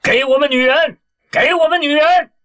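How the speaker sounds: very high, loud mob chant; angry crowd shout. Acted. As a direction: yell it with the furious crowd. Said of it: "群情激愤地喊着".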